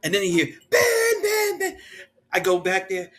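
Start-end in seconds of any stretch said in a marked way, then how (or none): 0.7-1.8 high screaming chant of a name; fans screaming. Retold or imitated. Crowd of fans screaming for Ben, high shrieks.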